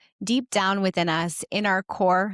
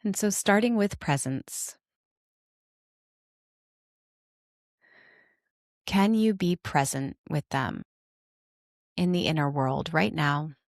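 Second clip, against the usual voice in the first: guided meditation; 3 semitones lower than usual. Acted. Host inviting listeners to notice their parts, guided meditation. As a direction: guide them inward, unhurried and calm, settling lower than chatty talk.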